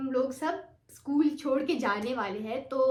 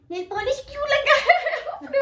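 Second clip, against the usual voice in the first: high, loud mock teacher demanding with a laugh; "लगाया" pushed high and loud like an angry teacher; scolding teacher; 12 semitones higher, 7 dB louder than usual. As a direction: play the teacher grilling a student, half laughing. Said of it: "मुझे विश ने बुलाया".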